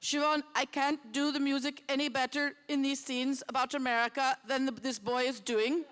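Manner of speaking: a self-admittedly weak Danish accent impression. Quoting the Danish composer's praise to the festival crowd, in a mild Danish accent. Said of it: "in his Danish accent".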